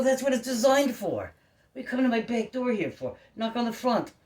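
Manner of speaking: loud, high That's what it's designed for; heated shout. Retold or imitated. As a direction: shout it at him, exasperated. Retold heated argument with cop.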